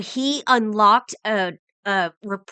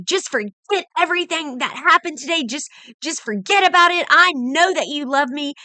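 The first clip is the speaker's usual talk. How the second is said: mock yell, pitched well up; retold yelling; 6 semitones higher than usual. Grandpa ordering granddaughter to forget it, retold yelling. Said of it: "he just starts yelling at me".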